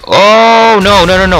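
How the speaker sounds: high, loud wail of dismay; alarmed cry. Real, not acted. Player reacting to a near miss, alarmed cry. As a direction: cry out as the chance slips away.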